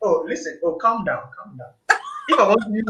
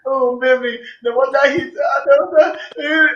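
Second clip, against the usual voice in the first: higher, louder, stammering panic; flustered panic; 7 semitones higher, 5 dB louder than usual. Retold or imitated. Partner burnt by hot water, stammering in panic. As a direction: blurt it out, caught and scared. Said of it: "come to me and tell me".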